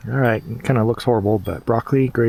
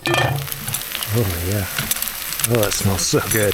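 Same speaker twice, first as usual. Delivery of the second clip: savoring a smell; much slower than usual. Real, not acted. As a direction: relish the smell of dinner. Smelling hot dinner in camp.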